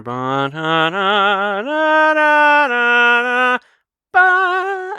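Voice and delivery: high, slow singsong mimicry of a whirring glider; imitated glider sound. Reviewer imitating the Goblin's glider approaching, high drawn-out hum. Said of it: "you hear".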